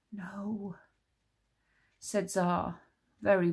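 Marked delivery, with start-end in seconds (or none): 0.1-0.8 soft, faint denial; stunned no. A narrator's reading. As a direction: barely breathe it out. Shocked denial.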